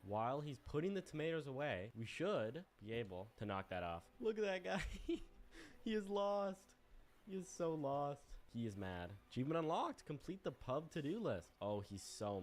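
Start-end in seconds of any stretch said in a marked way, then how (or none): 4.2-8.2 higher, cackling gloat; gloating laugh. Real, not acted. Goose watching the lost gardener, higher gloating laugh. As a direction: laugh at him from safety.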